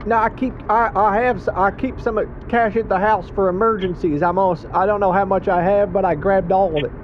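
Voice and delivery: flustered caller voice. Pretend. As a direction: play a frazzled driver on the phone.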